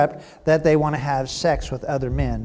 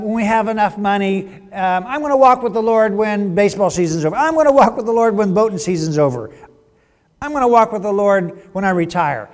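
higher, louder string of mock promises; lollygagger; 6 semitones higher, 6 dB louder than usual. Churchgoer putting off the Lord, mock promising. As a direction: lightly act a procrastinator, one excuse after another. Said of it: "the epitome of the lollygager".

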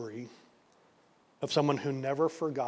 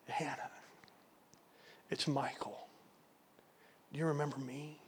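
much softer, near whisper; hushed, hoping she remembers; almost a whisper; 12 dB quieter than usual. Retold or imitated. Old man greets his lost love, almost whispering. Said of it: "almost in a whisper".

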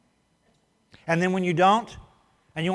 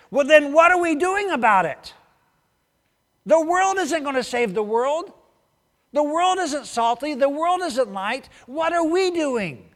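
much higher, louder preaching cry; preaching yell; 8 semitones higher, 5 dB louder than usual. Real, not acted. Preacher yelling at his congregation, much higher and louder. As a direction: shout the challenge at the church. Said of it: "Am I yelling?".

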